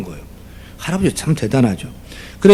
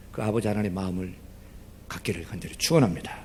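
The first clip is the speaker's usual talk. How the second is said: quiet, hushed pleading sermon; soft preaching; 10 dB quieter than usual. Acted. Pastor softly urging listeners to hold one person, hushed preaching. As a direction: draw them in softly.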